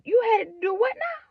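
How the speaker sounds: high, loud, crying disbelief; breaking into tears. Retold or imitated. Hearing cousin is going to the morgue, crying in disbelief. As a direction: voice cracking as it sinks in. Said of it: "I said".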